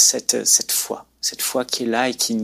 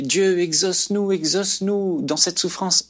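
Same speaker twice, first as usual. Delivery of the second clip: imitated cry to God; 7 semitones higher than usual. Suffering crowd calling to God in a vision, imitated pleading cry. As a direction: cry out in prayer, voice lifted but contained. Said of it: "il criait".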